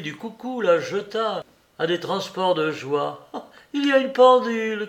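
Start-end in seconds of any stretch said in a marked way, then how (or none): 3.3-4.9 high, quick, loud cry of delight; excited shout at the cuckoo clock. A narrator's reading. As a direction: cry out with childlike joy. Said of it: "cria-t-elle".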